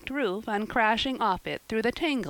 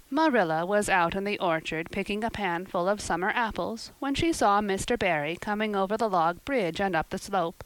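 normal talking voice

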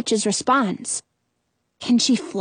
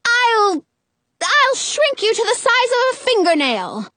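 high, sputtering threat; irate, high-pitched witch's tirade; hollering; 7 semitones higher than usual. A narrator's reading. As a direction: sputter with outrage, then lay down the law. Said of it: "Frau Gothel hollers".

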